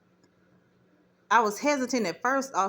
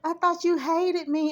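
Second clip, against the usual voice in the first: high, tearful mock sob of the godmother; retelling her crying plea, voice pitched high; imitating her crying; 8 semitones higher than usual. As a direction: plead it in a put-on teary voice, higher up. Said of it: "And she broke down crying".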